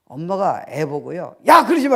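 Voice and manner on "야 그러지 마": high, loud scolding; mother's scolding. Retold or imitated. Mother scolds her child, loud scolding shout.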